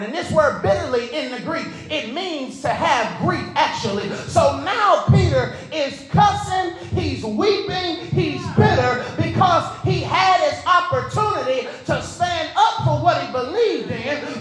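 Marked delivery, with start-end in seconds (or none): 4.3-14.4 preaching at full force. Acted. Pastor pressing the Broadway congregation, in full preaching force. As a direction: drive every line home like a revival preacher.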